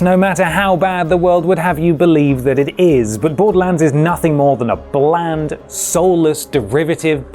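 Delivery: normal talking voice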